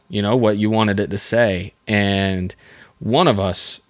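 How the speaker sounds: normal talking voice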